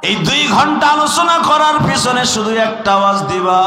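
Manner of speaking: high, loud preaching cry; impassioned waz call. Real, not acted. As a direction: rouse the crowd with a high call. Preacher calls out to crowd.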